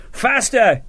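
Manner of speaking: high, urgent shout of command. A narrator's reading. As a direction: bark it at the men to speed up. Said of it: "he shouted".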